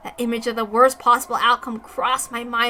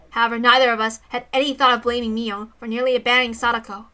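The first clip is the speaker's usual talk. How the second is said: usual speaking voice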